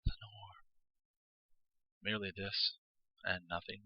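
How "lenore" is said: a faint whispered echo; a ghostly echoed whisper of the name; echo murmur. A narrator's reading. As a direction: let it drift back like a distant echo. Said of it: "an echo murmured back the word".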